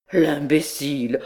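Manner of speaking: lightly voiced scoffing; a hint of mocking disbelief; mild scorn. A narrator's reading. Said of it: "un besoin de rire le saisit".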